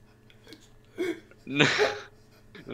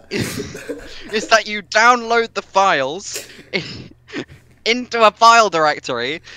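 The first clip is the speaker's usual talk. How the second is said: ordinary talk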